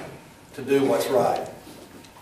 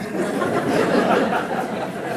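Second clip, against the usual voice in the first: laugh; 7 semitones higher than usual. Real, not acted. Laughs at memory.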